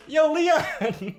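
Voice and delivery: high call breaking into laughter; calling a coworker over, laughing. Retold or imitated. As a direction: shout his name while cracking up. Calling coworker during robbery.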